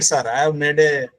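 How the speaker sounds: ordinary delivery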